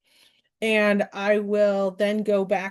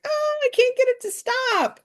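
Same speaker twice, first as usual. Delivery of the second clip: very high-pitched, hammed-up wail; mock wail. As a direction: squeal it in comic exasperation. Re-enacting own mishap from a story.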